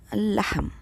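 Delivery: "al-lahm" with one long held double l; lam assimilated. Shown as a demonstration. Teacher models the sun letter lam, assimilated. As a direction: hold the l through "al-lahm". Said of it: "So the lam is assimilated".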